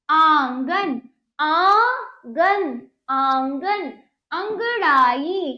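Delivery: each word separate, with long nasal vowels; deliberate teacher's model pronunciation; word drill. Shown as a demonstration. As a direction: stretch सूंड, पाँव, आंगन, अंगड़ाई for pupils to copy. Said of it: "आगे हम शब्दों को देख लेते हैं".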